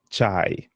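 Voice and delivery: "chai" said with a crisp ch; Swahili ch sound. Shown as a demonstration. Teacher repeating the key ch sound, demo. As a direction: model "chai" with the ch sound clearly. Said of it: "to form the sound ch".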